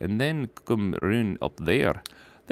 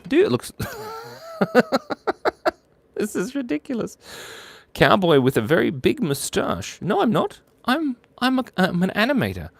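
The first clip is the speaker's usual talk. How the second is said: high silly voice; 12 semitones higher than usual. Pretend.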